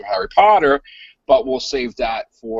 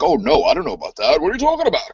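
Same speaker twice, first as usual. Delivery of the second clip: lightly acted innocent denial, rising higher at the end; mock feigned ignorance; 6 semitones higher, faster than usual. Host imitates director denying a leak, mock innocent denial. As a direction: play dumb with a laugh behind it. Director playing dumb about leaked news.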